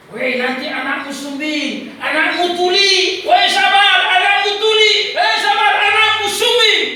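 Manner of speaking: high, drawn-out mocking shouts; devil's screaming taunt. Devil taunting Sabar about his unborn child, screaming taunt. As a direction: jeer loudly, building to a sustained yell. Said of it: "kayak iblis itu teriak-teriak".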